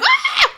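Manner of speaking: high, short yelp of pain; mock pain yelp. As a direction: yelp as if at a paper cut. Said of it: "I'm really bad with pain".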